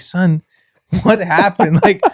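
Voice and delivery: animated, incredulous dad; retold astonishment. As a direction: question him in mock amazement, laugh breaking in. Retelling astonished dad questioning son.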